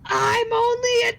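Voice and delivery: high, drawn-out tantrum cry; wailing protest. Acted. Player answering a taunt about being a child, wailing shout. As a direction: wail it slowly like an aggrieved kid.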